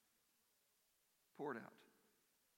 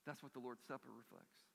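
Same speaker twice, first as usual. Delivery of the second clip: normal talking voice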